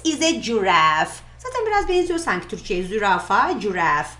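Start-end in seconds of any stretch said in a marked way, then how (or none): none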